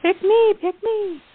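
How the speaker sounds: very high, eager pick me; eager child. Pretend. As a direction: bounce like a kid begging to be chosen. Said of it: "volunteers herself playfully".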